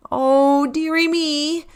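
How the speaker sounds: sheepish reindeer, a touch embarrassed; mildly embarrassed Rudy; lightly voiced Rudy. A narrator's reading. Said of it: "said Rudy".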